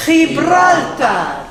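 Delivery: "Gibraltar" drawn out, with a throaty H for the G; Spanish pronunciation. Shown as a demonstration. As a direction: say "Gibraltar" the Spanish way, slowly, starting "khee". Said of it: "as the Spanish say".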